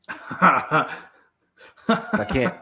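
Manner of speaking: a high laugh breaking into the word. Real, not acted. Blanking out on air, a laughing start. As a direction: laugh through it. Laughing while losing his train.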